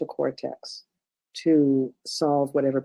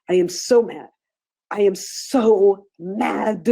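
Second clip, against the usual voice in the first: very slow and louder, each word stretched; drawn-out mad; 5 semitones higher, 5 dB louder, much slower than usual. Shown as a demonstration. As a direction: lean into the anger, slowly and forcefully. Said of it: "you're tapping and you're saying".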